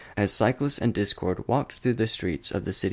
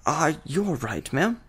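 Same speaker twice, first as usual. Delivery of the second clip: stuttered; 8 semitones higher, slower than usual. A narrator's reading. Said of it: "Goodward stuttered".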